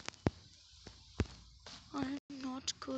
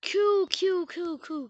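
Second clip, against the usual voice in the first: excited cool; 6 semitones higher, 11 dB louder, slower than usual. Real, not acted.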